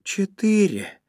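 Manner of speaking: high, loud, elated repeat; delighted. A narrator's reading. Zhenya repeats the number of keys, delighted. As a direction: savour it with rapture, pitch falling from high up. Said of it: "повторил Женя с восторгом".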